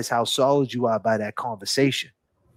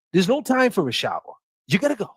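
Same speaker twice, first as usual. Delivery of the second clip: ordinary talk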